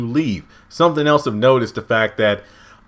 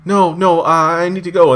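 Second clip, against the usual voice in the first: higher and louder, mock distress; high mock distressed woman; 6 semitones higher, 5 dB louder than usual. Lost woman refusing the cult member, high mock distress. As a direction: plead it up high like a lost stranger.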